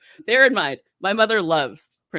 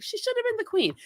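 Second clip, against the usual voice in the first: mom's voice; 4 dB quieter than usual. Retold or imitated. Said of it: "she's like".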